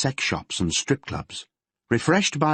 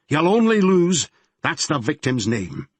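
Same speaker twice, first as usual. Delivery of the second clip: angry Glaswegian; 7 semitones higher, 4 dB louder than usual. Acted. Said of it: "his Glaswegian accent getting stronger the more angry he became".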